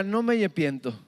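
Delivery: put-on a boy refusing to repent; stubborn child. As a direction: dig in your heels like a small boy. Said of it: "él decía".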